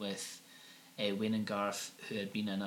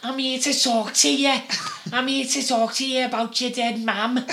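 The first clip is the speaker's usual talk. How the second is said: high, very loud hammed-up Liverpool accent; mock Scouse medium; 14 semitones higher, 12 dB louder than usual. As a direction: boom it out like a Scouse psychic. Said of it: "he has this strong Liverpudlian accent".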